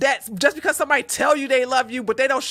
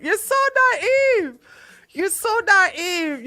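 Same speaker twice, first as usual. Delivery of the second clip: slow, high, drawn-out scolding; exasperated scolding; 8 semitones higher, slower than usual. Acted. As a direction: stretch it out like scolding a child. Scolding letter writer, repeated.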